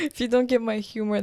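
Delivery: amused, laugh breaking into the words. Real, not acted. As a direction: say it while laughing. Laughing along after the sarcasm remark.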